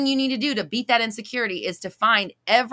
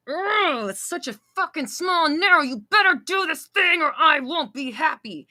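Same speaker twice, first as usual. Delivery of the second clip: mock whiny demand; 8 semitones higher than usual. Mocking the clingy voice that demands a partner behave. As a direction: sneer it like a bratty demand.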